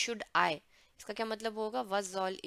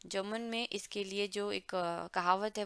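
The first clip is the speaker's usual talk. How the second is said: usual speaking voice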